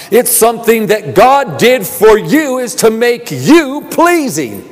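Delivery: impassioned pulpit delivery over music; full-force preaching. Acted. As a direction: lift the congregation, ringing and urgent.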